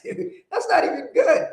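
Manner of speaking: high-pitched, laughing mock reproach; laughing disbelief. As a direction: scold a friend through laughter, voice way up. Laughingly retells scolding the friend.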